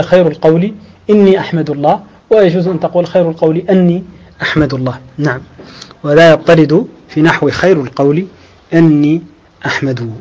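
normal talking voice

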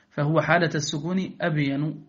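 usual speaking voice

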